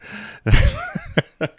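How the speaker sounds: high-pitched burst of laughter. Real, not acted. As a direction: crack up at your own joke. Laughing at own joke.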